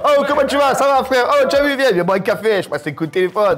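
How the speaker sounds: high, loud hammed-up Italian accent; Italian accent impression. Host parodies an Italian accent for her co-host, hammed up. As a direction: play a booming Italian friend on the phone. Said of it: "L'italien, comme il faisait Paga".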